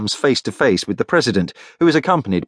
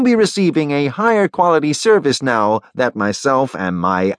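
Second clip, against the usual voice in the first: higher-pitched, polished announcement; Li Shan, broadcast presenter; 5 semitones higher than usual. Acted. Li Shan promises viewers a sharper picture, presenter announcement. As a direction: address the viewers brightly from on air.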